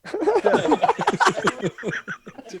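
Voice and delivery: high, loud burst of laughing; helpless high-pitched cackling; loud laughter. Real, not acted. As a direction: crack up loudly. Laughing at morbid joke.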